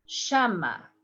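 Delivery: llama said as "shama"; sh sound. Shown as a demonstration. Teacher demos llama with sh. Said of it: "the three different ways".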